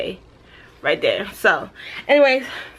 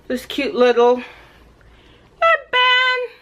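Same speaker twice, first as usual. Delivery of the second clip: cutesy excitement; 12 semitones higher, slower than usual. Real, not acted. Showing cute headband.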